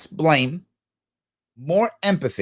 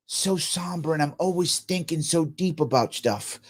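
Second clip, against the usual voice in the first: hushed, self-serious guru imitation; mock their deep pondering, much softer; mock-somber deep thinker; 7 dB quieter than usual. Host showing how gurus sound in interviews, mock somber. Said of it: "It's always this".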